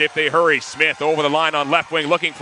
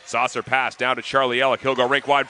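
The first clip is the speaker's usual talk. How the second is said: ordinary talk, nothing marked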